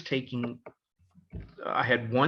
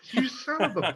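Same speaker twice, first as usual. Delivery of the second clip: high, laughing mock outrage; laughing curse; 9 semitones higher than usual. Student laughing at the coach who betrayed him, half-cursing.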